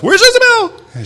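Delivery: loud, very high mock-child yell; panicked little boy. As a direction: mimic a frantic kid calling out. Imitates son's frantic question.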